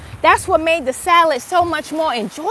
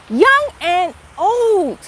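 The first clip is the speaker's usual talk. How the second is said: slow echo; 2 semitones higher, much slower than usual. Real, not acted. Remembering family who died, slow heavy repeat.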